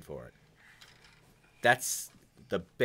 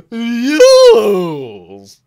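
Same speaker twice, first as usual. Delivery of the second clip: long, loud, high-pitched drawn-out yes; hammed-up yell swooping down at the end; Simpsons waiter; 12 semitones higher, 21 dB louder than usual. Streamer imitating a cartoon waiter, hammed-up shriek. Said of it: "he sounds like the waiter in The Simpsons".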